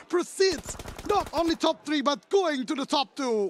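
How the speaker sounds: high, loud excited shrieks; shrieking jackpot winner. Real, not acted. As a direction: shriek like you just hit the jackpot. Brunei surge, casters erupt.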